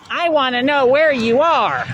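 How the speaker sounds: high, whiny mock voice of the stalkers; mocking quote. Ranting vlogger mocks his stalkers, high and whiny. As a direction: mimic them in a nagging voice. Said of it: "with the same exact quote, which is".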